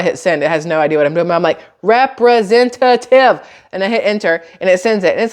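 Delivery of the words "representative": Representative stretched out very slow, each syllable spelled out; slow, drawn-out typing voice. Retold or imitated. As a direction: drag out Representative syllable by syllable, a bit louder. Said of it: "I typed it out slow".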